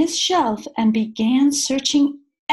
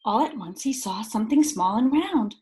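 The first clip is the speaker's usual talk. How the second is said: usual speaking voice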